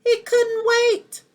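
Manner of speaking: storyteller hitting the happy ending, high and loud; excited. Acted. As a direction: burst with a child's eagerness.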